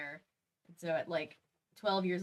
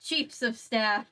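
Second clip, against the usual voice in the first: story narration; 3 semitones higher, 7 dB louder than usual. A narrator's reading. Reading the story aloud.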